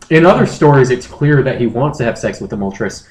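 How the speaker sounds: usual speaking voice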